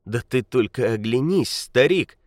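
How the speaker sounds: ordinary delivery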